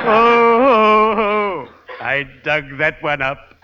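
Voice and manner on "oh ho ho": high cackling "oh ho ho"; ghoulish chuckle. Acted. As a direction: cackle like a gleeful gravedigger. Gravedigger chuckles at his joke.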